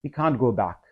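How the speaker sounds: ordinary talk, nothing marked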